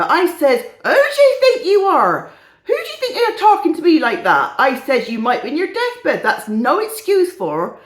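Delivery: put-on loud, indignant comic old crone; hammed-up gossiping old woman, high and outraged; Missus Brady, old lady. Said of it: "is a bit of an old crone".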